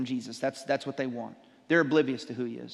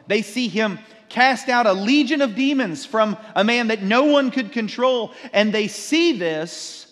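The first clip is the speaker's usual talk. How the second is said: louder, higher preaching build; building sermon; 5 semitones higher, 6 dB louder than usual. Acted. Preacher stirring the congregation, rising and louder. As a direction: drive the story home to the congregation.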